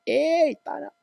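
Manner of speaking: high-pitched mock scolding mum; teacher imitating an angry mum for the taling e. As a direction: squeal it like a cross mother telling you off. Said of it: "macam mak kau marah".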